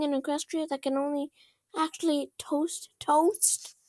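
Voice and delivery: Twilight lamenting her toaster to Rainbow Dash, high and overwrought; melodramatic princess. Acted. Grandly tortured, fast then slowed at the end.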